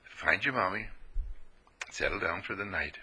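soft, low and tender, sending the lamb home; gentle shepherd. Retold or imitated. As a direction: hush it like tucking in a child. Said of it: "He just says".

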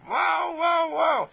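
very high, drawn-out exclamations; amazed wows. Acted. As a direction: marvel out loud in a high voice. Acts amazed at all those languages.

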